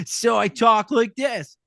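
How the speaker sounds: high, slow, drawn-out jerk voice; obnoxious guy. Acted. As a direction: do a bit of a dick. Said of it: "this guy is a bit of a dick".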